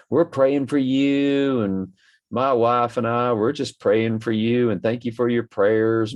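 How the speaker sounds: high, drawn-out preacher's patter; mock preacher. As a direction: mimic a preacher piously promising prayers. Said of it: "false preacher".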